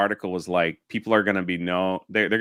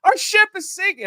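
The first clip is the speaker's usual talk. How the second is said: put-on much higher, louder child wailing for his dad; panicked kid; 7 dB louder than usual. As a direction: squeal like a little kid whose ship is sinking. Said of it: "you could hear the kid".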